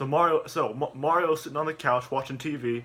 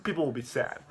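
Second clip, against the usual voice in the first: ordinary delivery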